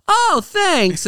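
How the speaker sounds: very high, squeaky cartoon kid; hammed-up high Naruto thanks; mock Naruto. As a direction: chirp it like a clueless kid who just got it. Naruto's reply to toad.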